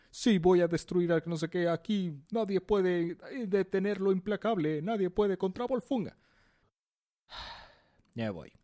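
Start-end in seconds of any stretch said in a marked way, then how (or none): 0.1-6.1 boastful promo, higher voice; wrestling promo. Retold or imitated. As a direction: hype yourself like a wrestler. Volfunga's boastful speech. (promo de lucha libre)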